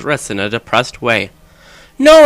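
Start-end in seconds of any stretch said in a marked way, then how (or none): 2.0-2.2 scream. Acted. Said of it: "I screamed sexily".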